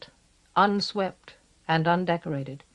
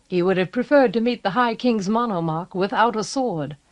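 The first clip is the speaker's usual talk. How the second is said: ordinary delivery, nothing marked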